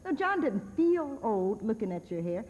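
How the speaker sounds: ordinary delivery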